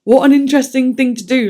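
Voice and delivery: normal talking voice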